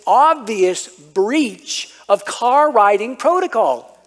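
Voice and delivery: hammed-up outrage, slowing toward the end; mock indignation. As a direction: play the wronged kid demanding justice. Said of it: "just the indignation".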